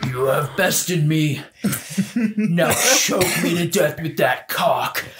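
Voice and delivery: slow, solemn dragon voice; hammed-up dying foe; defeated dragon. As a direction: draw it out like a vanquished beast. Voicing the defeated dragon's plea.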